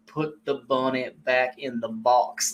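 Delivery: slow, hammed-up Southern accent; mock Southern accent. Shown as a demonstration. Showing the co-host the accent makes the line, mock Southern accent. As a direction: stretch it out in a fake Southern accent. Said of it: "the accent is to me what makes it".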